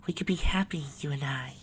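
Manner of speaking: high, airy whisper in the ear; soft angelic coaxing; sweet whisper. A narrator's reading. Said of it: "she whispered in her ear".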